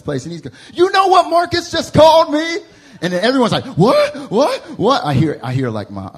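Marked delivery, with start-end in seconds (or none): 0.7-2.6 high, loud indignant report; outraged shout. Retold or imitated. Uncle storms in, outraged. 3.6-5.0 high, loud startled what; shocked family chorus. Retold or imitated. Family reacting to the news.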